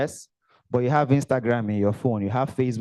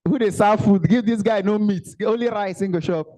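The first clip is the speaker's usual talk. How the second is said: joking call out; 6 semitones higher, 3 dB louder than usual. Real, not acted. Joking loud call to crowd.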